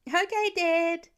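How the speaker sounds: put-on high, slow little boy reply; child voice. Young son answering his dad, high and happy.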